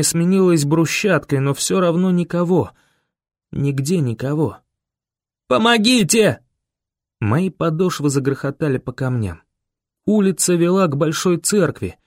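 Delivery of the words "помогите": high desperate cry; yelling for help. A narrator's reading. Boy yells on the run for help, yelling. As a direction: call out across empty streets. Yelling through empty town.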